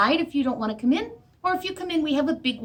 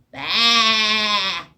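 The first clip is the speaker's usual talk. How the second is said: bleating goat; 3 semitones lower, 4 dB louder than usual. Acted. Librarian hears only goat bleat.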